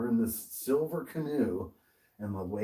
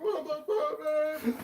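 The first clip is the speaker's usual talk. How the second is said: high-pitched tearful complaint; mock crying. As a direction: imitate a friend wailing in frustration. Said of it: "still fucking crying".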